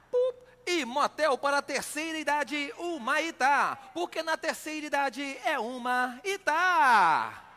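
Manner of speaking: slow, drawn-out sponsor announcer; mock ad read. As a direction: stretch the ad words out.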